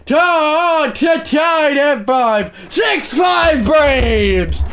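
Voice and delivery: put-on screaming like an overexcited toddler; screaming announcer call. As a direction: scream the comeback like a play-by-play man. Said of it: "I should not be screaming as loud as I am".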